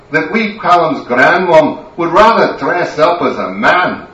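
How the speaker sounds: loud, high scolding that builds to a shout; furious Scottish wife. Acted. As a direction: drag him home with a telling-off.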